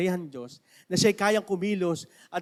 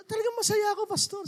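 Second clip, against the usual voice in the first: lightly voiced, higher, joyful old believer; happy Mamberto; 13 semitones higher than usual. Mamberto tells the pastor he is happy, higher joyful voice. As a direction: beam with joy at the visiting pastor. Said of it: "Sabi niya".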